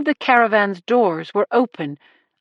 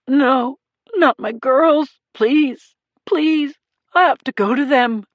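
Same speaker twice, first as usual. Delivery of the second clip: high, slow, pleading through tears; crying plea; 5 semitones higher than usual. A narrator's reading. Grieving husband pleading with cops, crying. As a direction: beg to be let go to them. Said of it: "as Marone cried".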